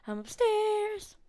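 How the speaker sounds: high, slow sung-out yell; yelling across the room. Real, not acted. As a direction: call out long and loud, operatic. Calling monster again.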